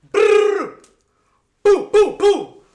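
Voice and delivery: shouted gun noises, very high; mock gunfire. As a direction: blast out a mock gunshot. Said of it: "and they went".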